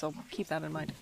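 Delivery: ordinary talk, nothing marked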